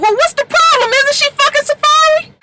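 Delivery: very high, taunting comeback; mock sassy retort. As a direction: fire back at the angry mother. Paris retorting to Lyrica.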